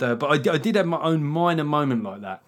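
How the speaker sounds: ordinary talk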